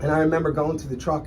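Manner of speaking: usual speaking voice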